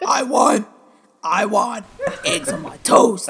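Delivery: muffled Bane voice, hand over mouth. Retold or imitated. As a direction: speak through your hand like Bane. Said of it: "everyone now can do a Bane voice".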